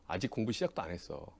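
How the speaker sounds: ordinary talk, nothing marked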